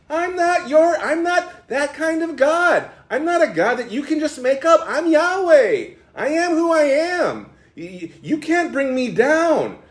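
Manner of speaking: high-pitched, emphatic divine rebuke; indignant God. Acted. God rejecting their conception of him, indignant. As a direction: declare each refusal firmly, stretching key phrases up high.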